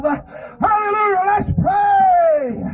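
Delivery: high, drawn-out revival cry; impassioned, soaring preacher's shout; shouted call to prayer. Real, not acted. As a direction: wail it out to rally the congregation.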